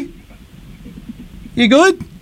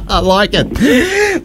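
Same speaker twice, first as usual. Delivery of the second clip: laughing; 5 semitones higher, much slower than usual. Real, not acted. Host amused by cheeky answer.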